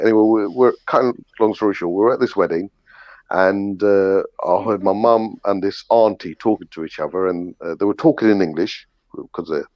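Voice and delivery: ordinary delivery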